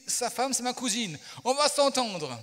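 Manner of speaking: high, loud exclamation; delighted banker. Retold or imitated. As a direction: imitate the banker thrilled by a connection. Acts out banker's delighted discovery.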